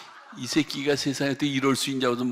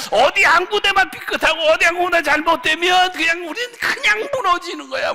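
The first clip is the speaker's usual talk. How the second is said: high, loud fiery preaching; fervent sermon shout; 12 dB louder than usual. Real, not acted. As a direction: cry it out over the congregation. Preacher at full force.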